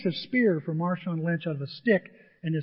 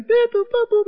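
very high, loud censor beeps; bleeped cussing; 6 dB louder than usual. Retold or imitated. Player cusses at the charging pig, mock bleeping. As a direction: imitate bleeps over panicked swearing. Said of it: "He's cussing up".

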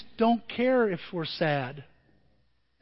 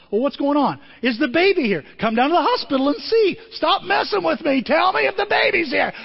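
impatient excited pleading; 7 semitones higher, 4 dB louder than usual. Retold or imitated. Pastor pressing son on phone.

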